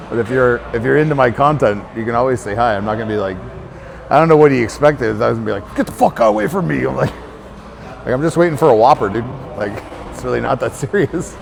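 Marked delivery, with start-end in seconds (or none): 5.7-6.8 mock hostile rebuff. Acts imagined hostile rebuff.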